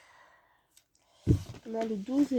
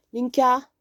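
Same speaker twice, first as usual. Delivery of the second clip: calling out; 4 semitones higher, 11 dB louder than usual. Real, not acted. Calling someone from across room.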